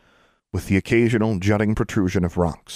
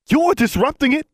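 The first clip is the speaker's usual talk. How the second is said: furious high shout; 6 dB louder than usual. Acted. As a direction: explode at the governor. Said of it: "Mister Johnson shouted".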